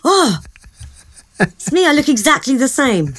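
high-pitched pretend amazement at meeting yourself; a put-on gasp of recognition; mock surprise. Younger self meets future self, high-pitched mock surprise. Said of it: "and I go".

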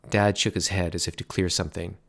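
ordinary delivery

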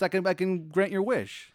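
high, pleading genie voice. Acted. Genie offering to grant a wish, high and insistent. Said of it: "He goes".

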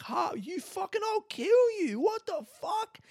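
very high-pitched mock outrage; re-enacted hysterical indignant rant; going off on her. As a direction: squeal with comic fury, stretching the last words. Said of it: "I just went off on her".

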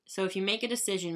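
ordinary delivery

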